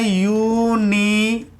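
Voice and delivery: यूनिवर्सिटी drawn out slowly, syllable by syllable; slow demo word. Shown as a demonstration. Teacher sounding out यूनिवर्सिटी slowly for students. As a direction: spell out यूनिवर्सिटी slowly for the class. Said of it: "तो हम किस प्रकार से लिखते हैं?".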